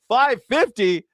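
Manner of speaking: high, thrilled disbelief; mock delight. Mimicking the mindset on gas prices, mock delight. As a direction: act amazed at a bargain. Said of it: "that's the mindset now".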